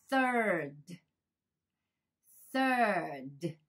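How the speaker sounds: "third" drawn out with a distinct final d; final D sounded. Shown as a demonstration. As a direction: model "third" carefully, adding the d at the end. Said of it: "簡単に最後にDがついてる". (最後のDまで「third」を丁寧に発音)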